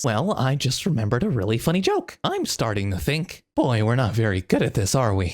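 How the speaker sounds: throaty, clueless voice flipping toward falsetto; low goofy voice discovering each thought; Kinger. Acted. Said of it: "it'll sound something like this".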